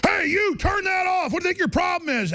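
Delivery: imitating an angry man in the street. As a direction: bark it out like an angry stranger. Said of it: "and go".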